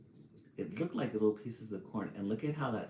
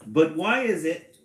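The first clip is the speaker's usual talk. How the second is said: loud, higher stab at an Irish lilt; attempted Irish accent; 7 semitones higher, 10 dB louder than usual. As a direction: try a leprechaun's Irish accent, loudly. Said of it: "I'm gonna try".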